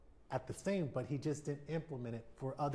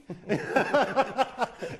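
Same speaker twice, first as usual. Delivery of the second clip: a loud, high burst of real laughter; 11 semitones higher, 14 dB louder than usual. Real, not acted. Telling the interviewer about his son, a loud real laugh. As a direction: let a big laugh burst out.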